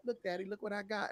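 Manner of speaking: put-on high, soft, quick girlish voice; imitating girlfriend. Girlfriend showing off her kit, high and soft. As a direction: play the excited girlfriend lightly, high and soft. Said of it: "she was like".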